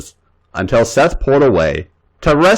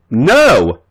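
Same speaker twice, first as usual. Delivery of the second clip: objecting cry; 3 semitones higher, 4 dB louder than usual. Acted. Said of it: "she said languorously".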